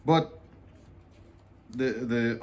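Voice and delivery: usual speaking voice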